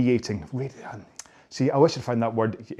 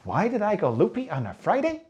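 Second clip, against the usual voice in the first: put-on high, sing-song children's presenter question; Dora the Explorer; 7 semitones higher than usual. Coach quizzing viewers like small children, hammed-up presenter voice. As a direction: ask it like a kids' show host. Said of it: "It's like Dora the Explorer".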